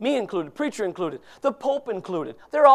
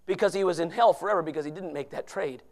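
normal talking voice

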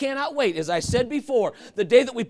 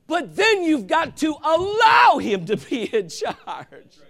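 slow, loud, higher preaching shout; emphatic shout; 7 semitones higher, 5 dB louder, slower than usual. Real, not acted. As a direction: hammer the point home to the church. Preacher shouting the key point.

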